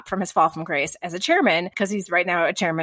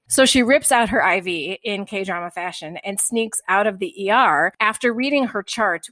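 normal talking voice

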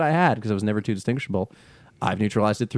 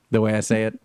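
ordinary delivery

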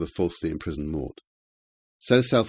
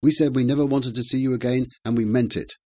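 raised, high-pitched indignant cry; angry shout; 7 semitones higher, 6 dB louder than usual. A narrator's reading. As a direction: shout the visitor away from the door. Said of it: "she shouted".

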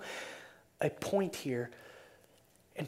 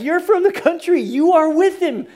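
loud, high accusing cry; insistent accusation; 10 semitones higher, 9 dB louder than usual. Acted. Preacher as the accuser pinning Peter down, loudly insistent. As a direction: press the charge with raised voice.

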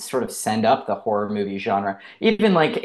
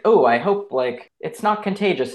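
higher, lightly acted scared voice; mild mock fright; 6 semitones higher, 4 dB louder than usual. Germaphobe character fleeing a sick castmate, mildly scared. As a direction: play a timid germaphobe, half in earnest. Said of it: "very scared of his own shadow".

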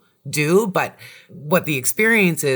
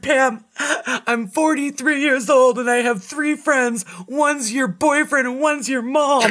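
high, laughing mock-whine of a friend's confession; retelling friend's lament; 9 semitones higher, slower than usual. Wife retelling friend's breakup complaint, high mock whine. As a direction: imitate his teary complaint with a laugh. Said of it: "and you said".

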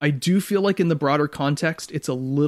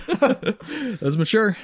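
high, very slow sarcastic "That's mature"; playful sarcasm about the author preferring the show; mock-impressed drawl; 10 semitones higher, much slower than usual. Real, not acted. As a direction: stretch it out, teasing fake admiration. Sarcastic praise of author's remark.

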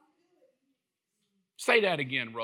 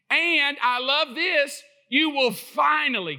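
a big, loud grand voice for God, pitched higher; booming God voice; 9 semitones higher, 7 dB louder, slower than usual. Retold or imitated. Preacher hamming up a showy God for the congregation, loud and grand. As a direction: proclaim like a showy genie-God. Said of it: "can't you hear his voice in that?".

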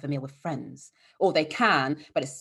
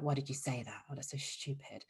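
soft, lowered inner-critic voice, quieter and quieter; mock inner critic; 3 semitones lower, 11 dB quieter than usual. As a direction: play the harsh voice in your head, quietly. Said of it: "that one that tells us that".

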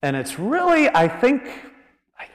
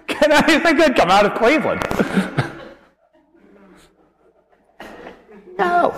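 giggling through a joke about Cleveland; laughing; 10 semitones higher than usual. Real, not acted. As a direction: squeeze the question out while cracking up. Preacher cracks up at own joke.

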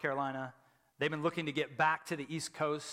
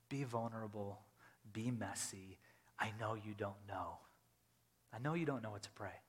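gentle Spirit; 5 semitones lower, 10 dB quieter than usual. Retold or imitated. Spirit invites honest prayer.

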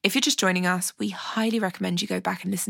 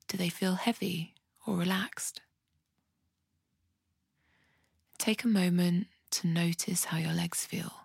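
soft, unhurried body-scan voice with long pauses; guided meditation; 8 dB quieter than usual. Acted. Podcast host guiding listeners through a compassionate body scan, soft meditation voice. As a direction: guide them gently through the body, leaving space to breathe.

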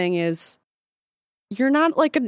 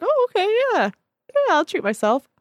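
imitating herself, much higher and bright; mock cheerful; 12 semitones higher than usual. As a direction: play it light and self-indulgent, voice up high. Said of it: "And I was like".

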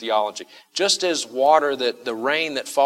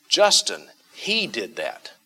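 HE drawn out slowly after a raised JUSTIN; emphatic; slower than usual. Shown as a demonstration. Someone telling the preacher about Justin, emphatic. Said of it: "You see the emphasis".

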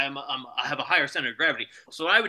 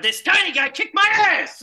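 high, loud disbelieving yell; loud, high-pitched indignant complaint; outraged cry; 13 semitones higher, 7 dB louder than usual. Pretend. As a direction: wail it out in mock outrage. Reacting to losing tooth in sparring.

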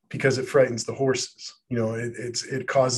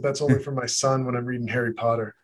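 ordinary delivery

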